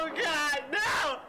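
high-pitched wail of dread; panicked cry. Real, not acted. Player scared by the game, wailing in panic. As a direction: plead in sudden fright.

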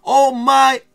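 high, loud, drawn-out hype shout; excited. Real, not acted. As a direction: shout each word with huge excitement. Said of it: "I'm excited".

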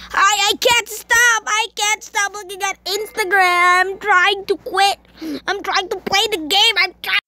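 high, insistent mock-desperate voice; mock desperate plea. Gamer tells viewers they can't quit Instagram, mock desperation. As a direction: plead it high, as if helpless.

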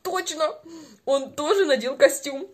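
ordinary delivery

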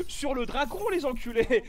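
normal talking voice

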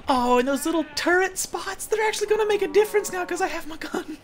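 high-pitched giddy rush of excitement; excited. Real, not acted. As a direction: gush with delight, way up high. Thrilled about new gun.

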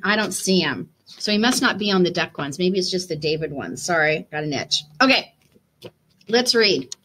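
usual speaking voice

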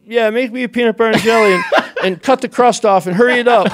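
giggly mock-bossy request; laughing joke. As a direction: tease while laughing. Joking bossy order, laughing.

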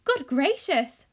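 loud, slow, shocked exclamation; cried out. Acted. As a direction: gasp it out in shock. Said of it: "cried Charlie".

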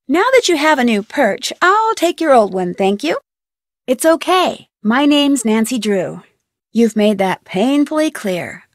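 ordinary talk, nothing marked

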